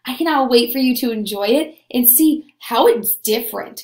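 normal talking voice

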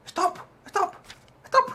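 very high, loud cries; frantic yelling. Acted. Trying to stop the fight by phone, frantic yell. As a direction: yell desperately to interrupt them.

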